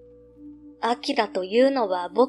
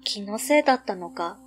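ordinary delivery, nothing marked

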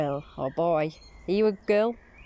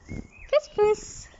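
high sing-song call to a cat. Real, not acted. Owner calls the cat in a high voice.

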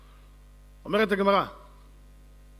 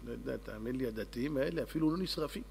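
very soft, low bewildered answer; acted baffled reply; 6 semitones lower, 11 dB quieter than usual. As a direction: drop right down, as if at a loss. Bewildered onlooker answers the king.

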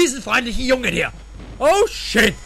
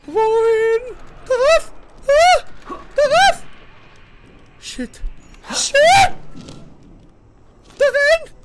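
being chased; 12 semitones higher, 3 dB louder, slower than usual. Real, not acted. Searching escape route under pursuit.

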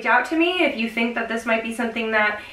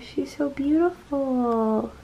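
softer, slow, in wonder; awed hush; 5 dB quieter, much slower than usual. Real, not acted. Vlogger filming the moon, softly amazed. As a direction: breathe it out, lost in the moon's beauty.

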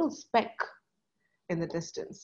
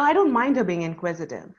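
normal talking voice

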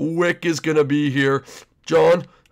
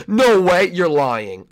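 ordinary talk, nothing marked

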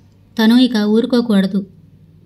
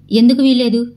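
plain reading voice

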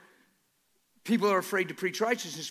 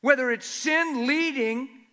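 high, loud, slow impassioned preaching; preacher lifting the whole room; full-force preaching; 8 semitones higher, 8 dB louder, slower than usual. Acted. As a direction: build the sermon to a ringing climax.

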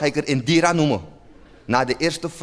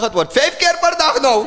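gleeful thought; 9 semitones higher, 4 dB louder than usual. Retold or imitated. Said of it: "ik dacht".

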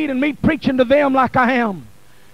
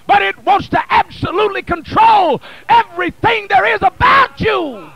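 building from steady to a loud, higher cry on blood; preaching at full force; 5 semitones higher, 4 dB louder than usual. Acted. Preacher urging the congregation, rising to full-force preaching. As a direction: whip the church up, climbing each line. Preacher calls after Judas.

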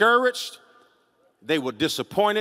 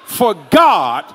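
full-force preaching; 6 semitones higher, 13 dB louder than usual. Real, not acted. Preacher urging the congregation to hold on, full-force preaching. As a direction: build each phrase into a ringing shout. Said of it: "don't y'all make me preach".

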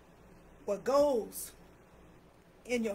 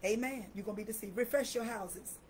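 normal talking voice

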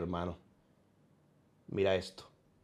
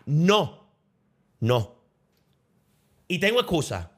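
ordinary talk, nothing marked

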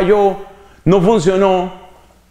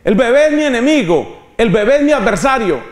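inner voice dismissing babies; 8 semitones higher than usual. Retold or imitated. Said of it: "llevas quince años diciéndole a tu cerebro".

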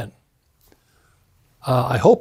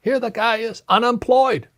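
incredulous retort; 8 semitones higher than usual. Retold or imitated. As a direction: push it up in outraged disbelief at his dad. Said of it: "I said".

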